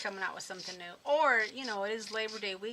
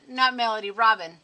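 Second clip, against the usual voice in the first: sassy loud reply; 13 dB louder than usual. Real, not acted. Crafter loudly teasing a viewer in chat, sassy and excited.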